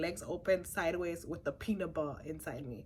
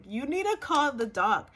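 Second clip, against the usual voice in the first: urgent demand; 2 semitones higher, 5 dB louder than usual. Retold or imitated. Laboring patient demanding the doctor from her nurse, urgent. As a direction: push it out forcefully, as an ultimatum.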